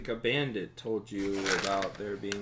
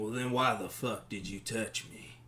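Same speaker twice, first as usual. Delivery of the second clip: surly bar patron; slower than usual. Acted. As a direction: grumble a challenge under the breath. Game master plays surly bar patron.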